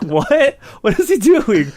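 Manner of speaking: mock disbelief; baffled onlookers. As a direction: onlookers laughing at a man pointing a remote. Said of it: "everyone's like".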